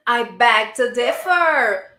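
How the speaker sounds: loud, drawn-out delighted exclamation. Real, not acted. Teacher thrilled by a student's answer, delighted. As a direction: read it out loudly, savouring each word.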